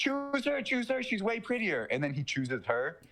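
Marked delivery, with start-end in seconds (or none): 0.0-1.8 much higher squeaky pleading voice; high mock plea. Teller voicing the podcaster lady begging the killer, high and squeaky. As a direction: squeak it out like a scared woman.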